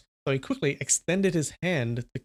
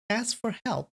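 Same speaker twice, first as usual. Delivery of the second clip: high-pitched teasing question; light, high, amused character voice; Blue Phoenix, mocking; 8 semitones higher, 4 dB quieter than usual. A narrator's reading. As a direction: taunt him in a put-on higher voice. Blue Phoenix interrupts, mocking him.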